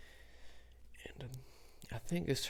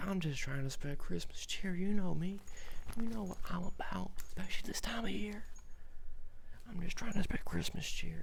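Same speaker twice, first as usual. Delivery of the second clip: much higher, excited pitch; eager plea; 9 semitones higher, 3 dB louder than usual. Retold or imitated. Storyteller pleading with Santa to spread cheer, eager. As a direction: gush about Christmas cheer.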